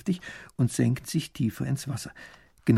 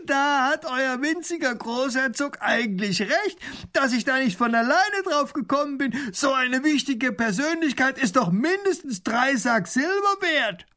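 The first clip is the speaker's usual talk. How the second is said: booming robber voice; 13 semitones higher than usual. Acted. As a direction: crow over the rich visitors with a big jeering laugh. Robber mocks grand duke.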